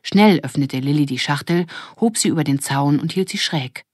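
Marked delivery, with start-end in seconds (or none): none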